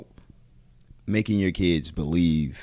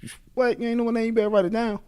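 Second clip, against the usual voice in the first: higher, stammering then swaggering; cocky boast; faster than usual. Retold or imitated. Young wrestler boasting to the crowd, high and cocky. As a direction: brag like the crowd owes you. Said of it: "And me, I'm like".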